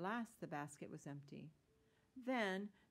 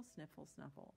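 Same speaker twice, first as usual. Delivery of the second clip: ordinary delivery, nothing marked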